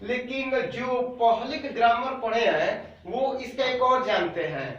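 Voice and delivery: ordinary talk, nothing marked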